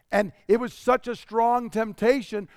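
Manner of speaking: ordinary delivery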